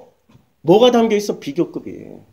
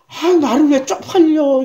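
raised, slow, exasperated complaint; mock irritated pastor; 6 semitones higher than usual. Pastor griping at his wife. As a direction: whine it like an embarrassed pastor. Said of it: "이렇게 욕도 하고".

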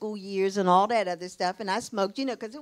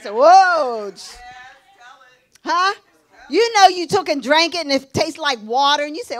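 loud, higher-pitched sermon delivery; punchy, raised preacher's voice; full-force preaching; 5 semitones higher, 7 dB louder than usual. Acted. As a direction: exhort the congregation with rising fervour.

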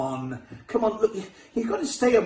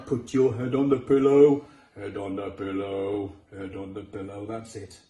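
soothing; 11 semitones lower than usual. Real, not acted. Coaxing puppet to sleep.

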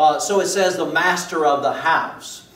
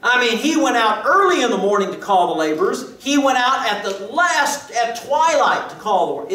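higher, louder, rising sermon voice; fervent preaching; 7 semitones higher, 5 dB louder than usual. Acted. Preacher telling the congregation of the call, fervent. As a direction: preach it out with mounting fervor. Preaching rises to full force.

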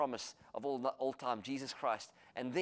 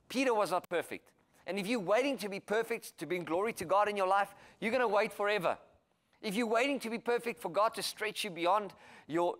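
higher, louder, urgent preaching; preaching at full force; 6 semitones higher, 4 dB louder than usual. Acted. Preacher urging believers not to wait for perfection, fervent. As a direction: drive it home to the congregation.

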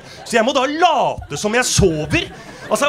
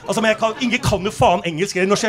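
ordinary delivery

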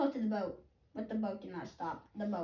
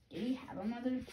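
usual speaking voice

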